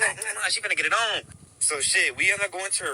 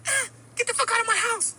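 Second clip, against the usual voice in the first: angry woman. Retold or imitated. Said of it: "she ended up like".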